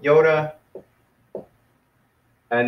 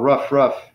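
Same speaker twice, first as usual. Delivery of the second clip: mock dog bark, rough, rough; playful woof woof; dog bark imitation. Shown as a demonstration. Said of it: "It's like the dog".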